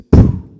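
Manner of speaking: sudden loud pum; shouted bang like a pistol; loud imitated gunshot. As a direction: burst out a gunshot sound.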